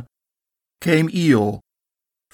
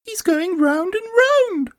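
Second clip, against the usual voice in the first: very high, slow little Roo voice; much impressed Roo. A narrator's reading. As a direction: marvel slowly as tiny Roo. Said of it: "much impressed".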